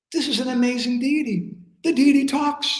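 high-pitched mock amazement, rising further at the end; amazed. Acting Radharani amazed at talking deity.

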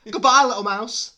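fast, very loud, high farewell; hammed-up panicky goodbye; fleeing fox. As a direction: shout it as you bolt. Fox flees in story.

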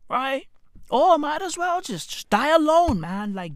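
normal talking voice

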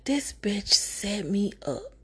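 slow, unvoiced whisper; whispered realization. Grace in her cell realizes she was set up, in a slow whisper. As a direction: breathe it out slowly as the truth dawns.

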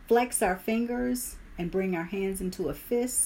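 ordinary talk, nothing marked

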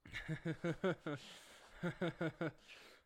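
high, long chuckle; laugh. Real, not acted. Co-host laughing at the stimulus check joke. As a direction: crack up in bursts.

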